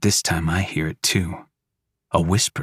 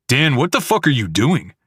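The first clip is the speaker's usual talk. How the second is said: angry demand; 6 semitones higher, 3 dB louder than usual. Acted. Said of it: "Jack says".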